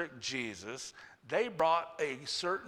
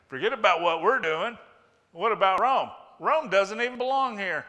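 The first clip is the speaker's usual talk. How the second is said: loud challenge; 5 semitones higher, 8 dB louder than usual. Acted. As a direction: call out to shift the blame. Pharisees challenging Jesus.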